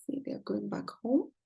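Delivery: soft hushed aside; storyteller whispering to children at bedtime. Acted. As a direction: whisper it to the little listeners.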